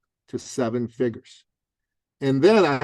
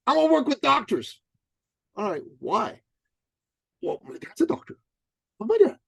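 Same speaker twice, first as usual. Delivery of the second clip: put-on high, naive young guy; eager trainer voice; 11 semitones higher than usual. Dan telling his coach about doctors, high naive voice. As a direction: voice him high and earnest.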